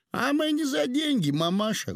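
very high, sing-out reply; high-pitched retort. A narrator's reading. Painter answers the old woman who whispered, high-pitched retort. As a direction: pitch it way up, easing down at the end.

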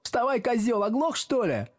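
high-pitched mocking holler; eager shouting. A narrator's reading. Classmates hounding the pupil up, high shout. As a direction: badger him in a high yell. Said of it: "услужливо закричали несколько голосов".

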